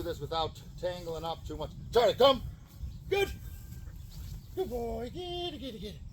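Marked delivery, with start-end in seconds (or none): 1.9-2.4 loud, high call across the yard; calling the dog. Real, not acted. 3.1-6.0 high, excited dog praise; high happy praise. Real, not acted. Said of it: "High and happy tone of voice".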